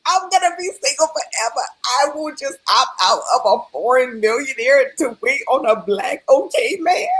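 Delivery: hammed-up cry of never finding a husband; mock wail. Despairing dream self, near tears.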